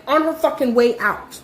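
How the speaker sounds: normal talking voice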